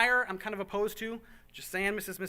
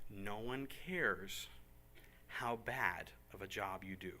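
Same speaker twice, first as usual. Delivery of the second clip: hushed and slow; 9 semitones lower, 10 dB quieter, slower than usual. Real, not acted. Pastor telling volunteers what truly matters, slow hushed emphasis. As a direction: drop to a low, slow hush to make it sink in. Urging volunteers to just try.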